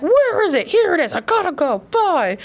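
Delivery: mock frantic dash for the door; rushing out. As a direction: toss each phrase out as if leaving in a hurry. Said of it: "I'm rushing".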